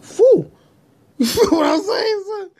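very high falsetto with a laugh; high-pitched, laughing punch line; squealed comic exclamation. Pretend. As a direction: shoot the voice way up into a giggly squeal. Caps rant with squealed exclamation.

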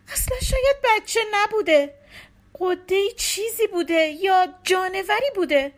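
hint of a grieving, suspicious wife; accusing wife. A narrator's reading. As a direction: lash out at her husband.

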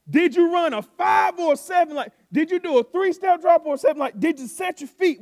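loud and high sideline questions; coach yelling questions. Retold or imitated. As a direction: fire questions at the player.

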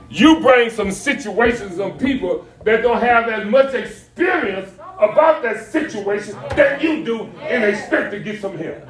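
rhythmic, insistent sermon delivery; animated preaching. Acted. Pastor pressing the congregation about seeking help, preaching. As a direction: punch out each phrase like a preacher.

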